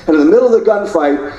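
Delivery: normal talking voice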